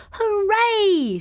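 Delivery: very high, loud hooray; shouted cheer. A narrator's reading. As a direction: shriek it out for the Aces. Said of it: "she shouted".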